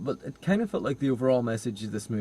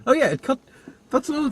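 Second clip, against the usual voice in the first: sudden recall; 14 semitones higher, 4 dB louder, faster than usual. Real, not acted.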